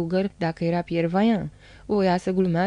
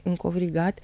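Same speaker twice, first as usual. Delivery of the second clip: ordinary delivery, nothing marked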